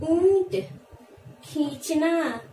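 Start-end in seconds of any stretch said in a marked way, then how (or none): 0.1-0.7 ईंट called out high and loud with a nasal ई; teacher calling out ईंट; emphatic demo. Shown as a demonstration. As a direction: call ईंट out clearly for the class. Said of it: "तो इसको क्या पढ़ेंगे?".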